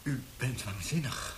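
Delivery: quiet horrified accusation; stunned, frightened. Acted. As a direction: breathe it in disbelief. Frightened prisoner facing the interrogator.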